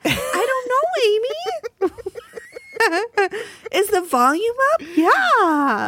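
tinny, thin sound with no bass. Real, not acted. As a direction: sounds tinny through a bad mic. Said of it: "Why do you sound tinny?".

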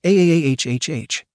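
long drawn AHHH cry. A narrator's reading. Lily crying out as Sir finishes, long moan. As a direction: let the aah stretch out.

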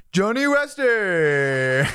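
drawn-out booming announcer call. Retold or imitated. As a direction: stretch it like a ring announcer. Imitating the event sound effect.

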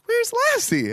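put-on high, eager boy asking; boy asking his parents for the dog; eager kid voice. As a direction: ask with a high, hopeful kid voice. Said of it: "he's like".

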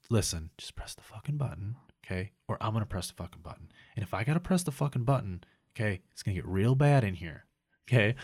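hushed, restrained mock menace. Customer warning the manager to press the button, hushed threat. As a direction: drop to a quiet, controlled warning. Retold threat at the service desk.

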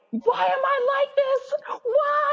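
very high, slow mock wail; upset with self. Writer condemning herself for not working, high mock-tearful wail. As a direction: wail it in hammed-up despair. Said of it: "so upset with myself".

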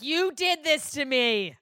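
mock accusing. Mother blaming her son, slowly and accusingly.

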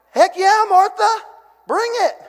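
high, loud hyped-up shout of approval; excited cheer. Retold or imitated. Preacher cheering Martha on, hyped and loud. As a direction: cheer her on like a fan in the pews.

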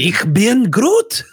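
loud, high, drawn-out German shout; angry German. Acted. Friend shows off Groot's line in German, angry German shout. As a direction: bellow it in harsh German, stretching the last word. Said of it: "Why does German have to sound like that".

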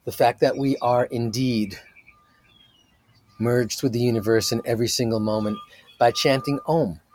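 slow, calm meditation voice; soothing guide talking a group through breathing; guided meditation. Acted. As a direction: ease them into stillness, unhurried.

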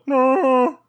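high, sudden Wookiee yelp. Acted. Wookiee barking at the leaving agent, high yelp. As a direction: let out a short creature cry. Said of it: "you hear the Wookiee go".

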